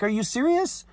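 high, rising indignant question; mad disbelief. Retold or imitated. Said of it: "I got mad with her".

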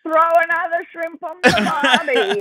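hammed-up Australian cliché; mimicked Aussie catchphrase. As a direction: parody tourists putting on an Aussie accent. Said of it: "people are like, "You're Australian".

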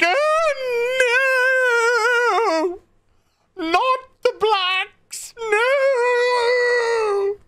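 shrill, very high, dragged-out wail; mock horror. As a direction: ham up a scandalized cry.

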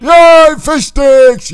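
high, loud gleeful cheer; excited cheer. Acted. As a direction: cheer like a thrilled kid at dinner. Cheering about dinner.

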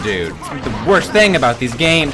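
ordinary talk

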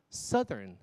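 SOUTHERN as "SUTH-ern"; short UH in "southern", unlike "south"; correct short vowel. Shown as a demonstration. Teacher modelling the correct vowel, "SUTH-ern". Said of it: "是southern".

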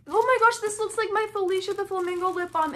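sudden high-pitched squeal; excited recognition. Real, not acted. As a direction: burst out high and delighted. Recognizes a lip balm she owns.

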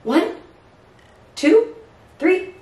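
rising, eager countdown; excited count. Real, not acted. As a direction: cheer the bird into flight. Counting the bird off to fly.

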